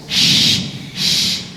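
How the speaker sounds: "shh" with lips drawn wide; sh pulled sideways. Shown as a demonstration. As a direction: spread the lips for a thin "shh". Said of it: "tarik ke samping". ("shh" ditarik ke samping)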